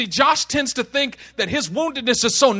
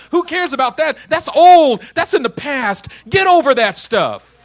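loud, raised brush-off; dismissive; 5 semitones higher, 8 dB louder than usual. Acted. Brushing off a ten-year-old stabbing, loudly dismissive. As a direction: wave it away loudly and impatiently.

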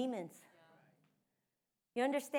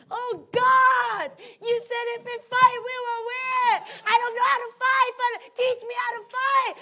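high, loud desperate crying out to God; wailing prayer; 11 semitones higher, 9 dB louder than usual. Retold or imitated. Pastor on her knees begging God, loud wailing prayer. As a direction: shout the prayer on your knees, pleading. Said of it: "And I'm wailing".

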